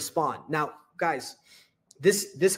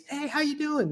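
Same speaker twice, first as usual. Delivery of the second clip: high-pitched, upbeat hello; smiling, energetic greeting; 10 semitones higher than usual. Retold or imitated. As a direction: greet them with an audible smile. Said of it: "They're smiling".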